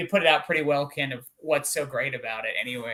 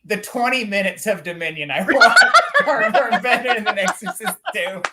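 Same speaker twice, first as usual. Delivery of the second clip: higher, louder, words breaking up with laughter; laughing through words; 4 semitones higher, 6 dB louder than usual. Real, not acted. Ranking the films for friends, helplessly laughing as he talks. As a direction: try to finish the sentence while cracking up.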